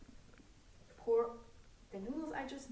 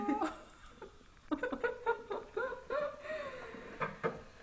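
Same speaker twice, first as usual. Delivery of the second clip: teary high laugh over onions; laugh-cry through stinging eyes; laughing while crying; 14 semitones higher than usual. Real, not acted.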